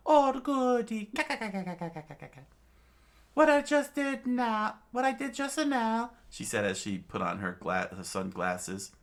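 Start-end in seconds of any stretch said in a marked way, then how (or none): 0.1-6.1 put-on old witch voice. A narrator's reading. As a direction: talk like a sly old witch doctor.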